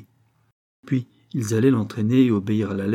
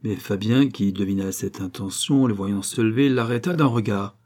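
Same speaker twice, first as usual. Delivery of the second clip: usual speaking voice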